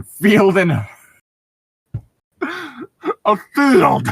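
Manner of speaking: slow, breaking into laughter; can't hold the laugh in; laughing through it. Real, not acted. Reading the scene aloud, cracking up laughing.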